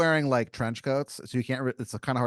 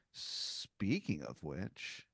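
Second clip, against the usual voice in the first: soft, drawn-out dawning thought; slow realisation; 5 semitones lower, 10 dB quieter, much slower than usual. Acted. Game master voicing a sudden thought, slow and soft. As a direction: stretch it out as suspicion dawns.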